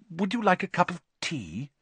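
high, timid, eager to please; breathless. A narrator's reading. Percy offering his idol tea, breathless. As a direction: offer it with nervous reverence. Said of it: "said Percy breathlessly".

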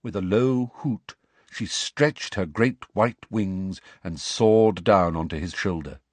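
usual speaking voice

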